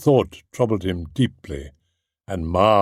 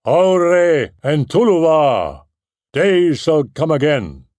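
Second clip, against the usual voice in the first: battle cry; 7 semitones higher, 4 dB louder than usual. A narrator's reading. Húrin alone against the orcs, a loud defiant battle cry. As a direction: ring it out like a warrior who will not yield. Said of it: "Húrin cried aloud".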